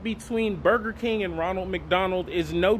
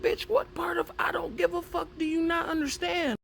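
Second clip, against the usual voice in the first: high, scornful comeback; mock defiant comeback; 11 semitones higher than usual. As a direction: snap it back, pitched way up. BK firing back at Ronald.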